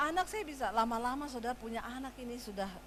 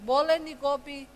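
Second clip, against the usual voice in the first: calling out; 3 semitones higher, 9 dB louder than usual. Retold or imitated. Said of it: "Suami saya teriak".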